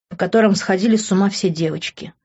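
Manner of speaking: ordinary delivery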